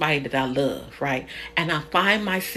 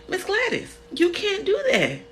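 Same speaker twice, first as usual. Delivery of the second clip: high scolding; 12 semitones higher than usual. Retold or imitated. Scolding Miss Gladys.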